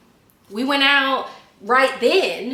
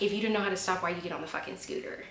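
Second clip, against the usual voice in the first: muttered inner thought; 10 dB quieter, much faster than usual. Retold or imitated. Silently fuming at the scooter rider, muttered aside. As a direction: grumble it softly under your breath. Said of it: "And in my head, I was just like".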